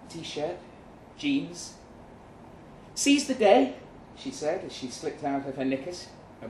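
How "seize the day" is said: higher, louder bold shout; loud, high carefree call; defiant cry. A narrator's reading. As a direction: fling it out boldly. Kate undressing, defiant.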